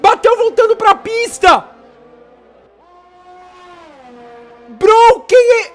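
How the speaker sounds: high shouted crash call; excited shout. Real, not acted. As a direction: yell the spin in shock. Calling a spin and crash.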